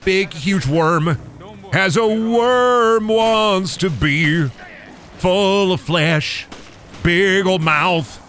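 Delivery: high, very slow mock-epic monster narration; slow drawn-out voice. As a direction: stretch every word like a grand creature announcer. Drawn-out voice describing the worm.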